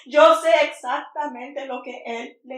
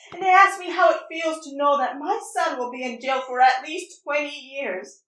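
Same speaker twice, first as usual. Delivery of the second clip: ordinary talk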